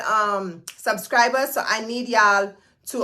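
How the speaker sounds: usual speaking voice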